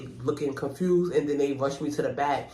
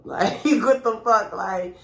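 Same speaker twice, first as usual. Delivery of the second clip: laughing; 6 semitones higher, 5 dB louder, slower than usual. Real, not acted. Laughing at the memory.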